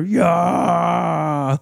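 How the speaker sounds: long, high shouted war cry; high, drawn-out battle yell; imitated war cry. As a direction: yell it out like a triumphant kid fighter. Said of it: "he's still doing it".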